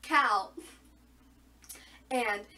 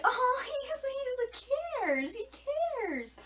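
high squealing gush, fading on cares; gushing quote; 13 semitones higher, slower than usual. Retold or imitated. Fan reenacting her reaction to Maven, in a high squeal. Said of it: "I was like".

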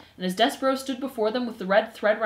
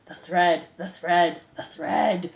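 lightly voiced low crowd chant; murmuring mice; 4 semitones lower, 3 dB louder, much slower than usual. A narrator's reading. Mice at the spool's arrival, murmuring. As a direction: repeat it in a slightly lowered murmur. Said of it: "murmured the mice".

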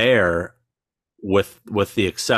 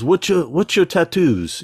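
higher-pitched imitation of a curious rock star; 9 semitones higher than usual. Imitating the idol pointing at tattoos, curious put-on voice. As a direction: put on the idol's voice, asking. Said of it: "he points, he goes".

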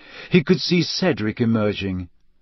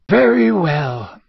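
put-on higher, drawn-out old headmaster; slow, deliberate acceptance in a raised voice; Dumbledore; 6 semitones higher, 4 dB louder, slower than usual. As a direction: say it slowly, with authority. Voices Dumbledore resuming his seat.

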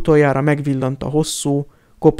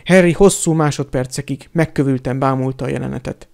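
plain reading voice

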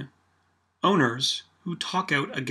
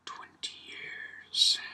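whisper; 12 dB quieter, much slower than usual. Real, not acted. Dramatic aside about Bettman's tenure.

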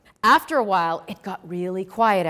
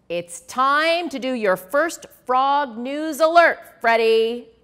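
encouraging Sally; 5 semitones higher, 5 dB louder, slower than usual. A narrator's reading.

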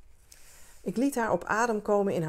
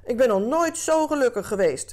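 loud, high, insistent call; crying out; 6 semitones higher, 6 dB louder than usual. A narrator's reading. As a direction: shout it out to prove it. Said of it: "riep hij". (luid en hoog uitroepen)

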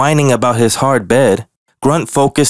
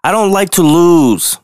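higher, sulky complaint; a cub sulking over a lost game; grumpy whine; 7 semitones higher than usual. Acted. Grumpy bear whining about losing. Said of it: "He was even grumpy during recess".

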